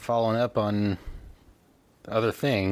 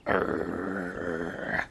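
dog growl; 2 semitones lower, 4 dB quieter than usual. Retold or imitated. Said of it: "when Scout starts growling".

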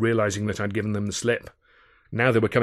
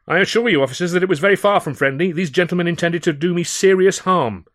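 urgent appeal; 9 semitones higher, 8 dB louder than usual. Acted. Narrator appealing to officers.

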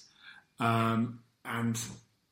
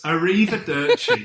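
Italian goodbye; 5 semitones higher, 6 dB louder than usual. Pretend. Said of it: "I've ended with an Italian thing".

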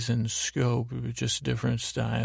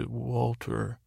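Mike's flat Walter; 4 dB quieter than usual. Retold or imitated. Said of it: "Mike says".

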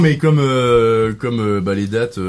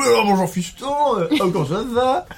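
imitating a father; 12 semitones higher than usual. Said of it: "C'est Jean Gabin".

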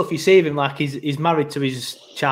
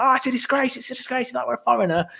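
mock outrage; 7 semitones higher, 4 dB quieter than usual. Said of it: "everyone's like".

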